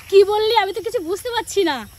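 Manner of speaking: loud, high retold shout of a deaf old aunt; hard-of-hearing aunt's shout. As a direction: call out loudly like someone who can't hear. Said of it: "বড় মাসি এমনিতে কানে কম শুনে". (কানে কম শোনা মাসির মতো জোরে চেঁচিয়ে)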